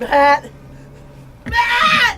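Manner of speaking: piercing high scream of a name; anguished shriek; desperate scream. Acted. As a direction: scream it into the distance. Screaming toward friend left behind.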